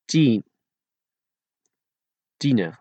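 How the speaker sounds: Tien and tiener with no puff on T; unblown T. Shown as a demonstration. Teacher showing Dutch letters, Tien and tiener with unblown T. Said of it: "without blowing those three letters".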